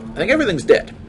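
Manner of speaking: ordinary talk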